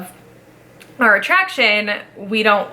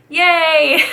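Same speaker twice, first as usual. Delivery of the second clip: cheerful yay; 7 semitones higher, 4 dB louder than usual. Retold or imitated. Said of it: "clap again and go".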